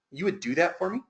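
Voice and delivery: retold, softly moved question; choked up. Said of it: "He gets choked up".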